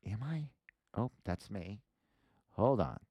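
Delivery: ordinary talk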